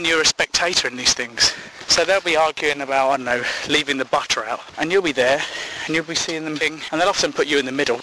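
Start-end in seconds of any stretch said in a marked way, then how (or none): none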